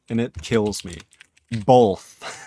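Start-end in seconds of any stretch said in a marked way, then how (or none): 1.6-2.4 "Both" said as "bolth", an L slipped before the th. Shown as a demonstration. Streamer showing chat her accent's bolth. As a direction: say "Both" with an L sound: "bolth", a little louder. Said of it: "I say both with an L".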